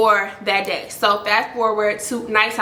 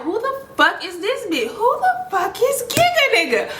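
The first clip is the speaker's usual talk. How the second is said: high-pitched, exasperated disbelief; retold irritation climbing to a high pitch; irritated; 11 semitones higher than usual. Storyteller replays her reaction to the pushy caller, irritated. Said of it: "I'm getting irritated".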